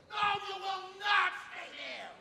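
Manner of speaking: high, loud, slow insistence; coach's shouted order. Retold or imitated. Coach overrules his stubborn runner, shouted order. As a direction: order her firmly, stretching each word. Coach argues with the stopped girl.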